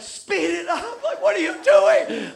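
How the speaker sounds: high, rising shout; freaking out. Retold or imitated. Choir leader freaking out at the dragging drummer. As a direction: panic at the drummer to speed up. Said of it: "She starts freaking out".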